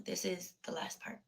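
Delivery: hushed whisper. Real, not acted. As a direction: whisper it like a secret. Dramatic aside to viewers.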